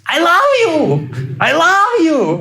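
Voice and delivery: hammed-up top-of-the-lungs love cry; imitated yelling. Retelling the lady on the radio, high mock yell. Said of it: "started yelling on the top of her lungs".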